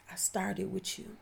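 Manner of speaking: normal talking voice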